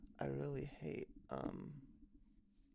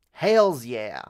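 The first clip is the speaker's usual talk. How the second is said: much louder, drawn-out cheer; big, loud hype shout; 19 dB louder than usual. Pretend. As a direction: belt it out to the stream. Repeats cheer louder, then softer.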